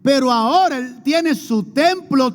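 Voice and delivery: fiery preaching. Acted. Preacher stirs the congregation, rising to a shout. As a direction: preach it hard to the crowd.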